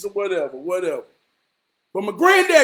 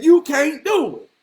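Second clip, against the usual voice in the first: lightly voiced granddad, higher pitch; granddad's voice; 6 semitones higher than usual. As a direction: imitate granddad's challenge, pitched up. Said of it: "That's how he talked".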